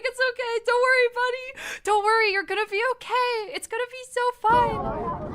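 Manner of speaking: high comforting voice to a scared stranger; mock reassuring. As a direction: coo it like calming a frightened buddy. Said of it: "I was like".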